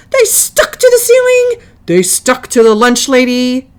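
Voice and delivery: ordinary delivery, nothing marked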